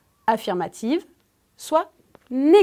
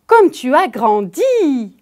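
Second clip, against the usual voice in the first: «Comme tu as grandi» louder and slow, leaning on «grandi»; stress on grandi; 6 dB louder, slower than usual. Shown as a demonstration. Teacher showing a pupil where to put the stress. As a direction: draw it out and hit «grandi» hard in amazement. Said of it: "J'insiste sur le mot «grandi»".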